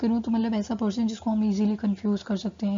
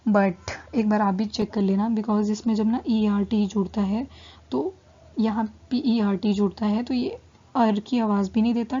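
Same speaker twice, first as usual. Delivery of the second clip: ordinary talk, nothing marked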